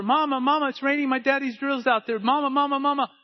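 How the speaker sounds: put-on high, urgent calls of a little boy; boy calling Mama. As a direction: knock and cry out for Mama. Boy calling mother through door.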